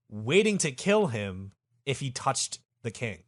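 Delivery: ordinary delivery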